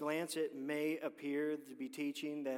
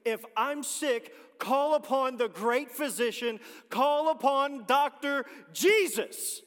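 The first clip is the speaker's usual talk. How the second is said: preaching at full force; 6 semitones higher, 11 dB louder than usual. Acted. Preacher to the congregation, in full-force revival preaching. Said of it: "let me say it how we say it back home".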